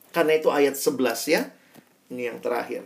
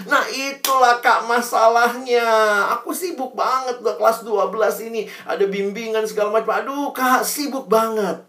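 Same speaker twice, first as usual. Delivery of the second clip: student excusing himself, whining high; whiny excuse; 7 semitones higher than usual. Retold or imitated. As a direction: complain like a busy teen.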